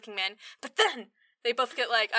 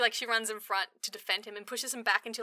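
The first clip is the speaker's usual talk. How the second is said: normal talking voice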